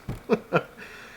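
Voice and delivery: high, airy burst of laughter; a giddy, unvoiced laugh of relief; breathless laugh. Real, not acted. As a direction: laugh out in disbelief. Reacting to getting the house.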